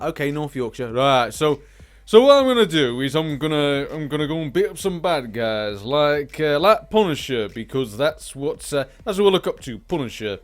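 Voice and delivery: a hint of a hard northern vigilante; gruff Punisher-style tough guy. Gamer imitates a hero bragging, mild tough-guy voice. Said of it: "So he's gonna have that kind of a, a voice to him".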